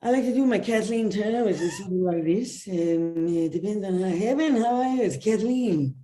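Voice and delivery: sultry, smoky star voice; Kathleen Turner voice. Shown as a demonstration. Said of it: "I can do".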